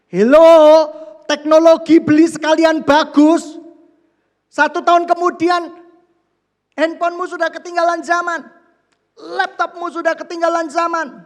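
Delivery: loud, high, mocking voice of the gadget pusher; loud mocking salesman. Preacher mocking people who push new gadgets, loud and high. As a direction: bellow it like a pushy tech seller scoffing.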